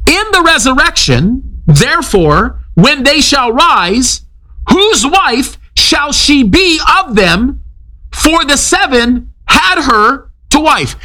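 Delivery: slow, high, measured scripture reading; a preacher slowly reading King James scripture; solemn scripture reading. Acted. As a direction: read the verse slowly, with weight.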